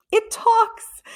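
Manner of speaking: thrilled squeal of discovery; amazed. A narrator's reading. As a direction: burst out in wonder. Excited discovery.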